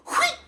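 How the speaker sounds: very high squeaky yelp; mock cartoon slip cry; slipping squeal. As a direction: squeal as feet fly out. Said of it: "They step in it and just go".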